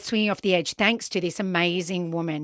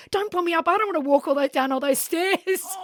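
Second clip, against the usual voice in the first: much higher, frantic begging; high pleading protest; 10 semitones higher than usual. Retold or imitated. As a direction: plead high and breathless not to be hauled up. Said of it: "And I'm like".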